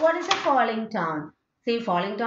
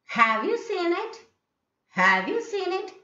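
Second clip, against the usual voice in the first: "Have you seen it" climbing high; high questioning rise on "Have you seen it"; rising tone; 9 semitones higher than usual. Shown as a demonstration. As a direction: push the pitch up high on "seen it". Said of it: "rising tone".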